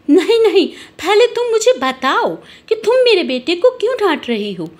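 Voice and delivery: high-pitched protest, cutting in; acted high, insistent objection; indignant mother. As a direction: demand an explanation for your child. Mother cuts in, defending son.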